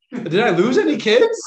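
high, loud panicked question; mock panic. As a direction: blurt it out in mock alarm. Quotes own mock panic.

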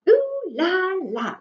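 drawn-out, high, hammed-up French "Ooh la la"; mock French. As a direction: savour it like a playful faux-French gasp of delight. Delighted French exclamation in story.

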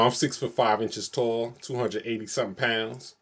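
ordinary talk, nothing marked

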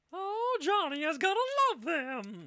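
very high put-on commercial lady voice; high mock housewife from an old ad. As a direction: squeak it like a cheesy radio ad.

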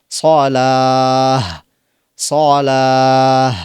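সালাহ drawn out slowly with a strong breathy h at the end; long final ha. Shown as a demonstration. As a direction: stretch সালাহ and end on a big h. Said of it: "বড় হা করে আপনাকে উচ্চারণ করতে হবে".

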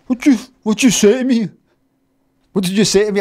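loud, high-pitched challenge; indignant confrontation. Retold or imitated. Going back to confront the French speaker, acted indignant. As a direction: demand an answer, acting out outrage. Acts out confronting the man who mocked him.

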